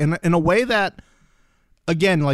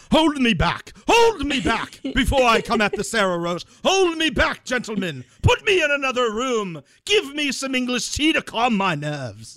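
hammed-up British accent, higher and pushed; mock British accent; 6 semitones higher than usual. Host imitating Martin raging, mock British accent. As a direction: play Martin begging to be held back, in a fake British accent. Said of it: "I gotta do it with the accent".

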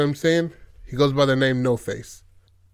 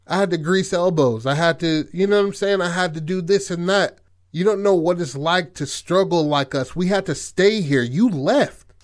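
higher, louder, heated tirade; acted outburst, pitched up and pushing; indignant rant; 6 semitones higher, 4 dB louder than usual. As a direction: let loose an exasperated, fed-up rant. Brother's angry rant in retelling.

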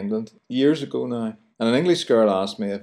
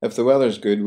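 ordinary talk

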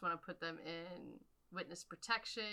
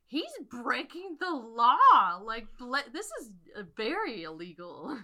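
mock outrage; 5 semitones higher, 12 dB louder than usual. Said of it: "And I'm like".

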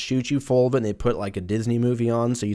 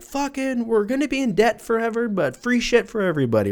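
high-pitched, hammed-up glee; giddy mock celebration; 13 semitones higher than usual. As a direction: play politicians gleefully throwing caution away. Acting out giddy politicians.

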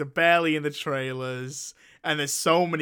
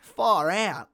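higher, jokey Australian drawl; mock Australian accent; 4 semitones higher than usual. British podcaster playing at being Australian, mock-Australian accent. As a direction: put on an unconvincing Australian accent. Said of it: "don't never say far out again".

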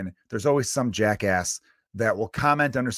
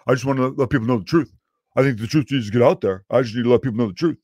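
louder, put-on smug voice defending himself; mock troll commenter; 5 dB louder than usual. Mocking a troll commenter who defends himself, in a louder put-on voice. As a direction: play the self-righteous troll insisting on the truth. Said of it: "they'll be like".

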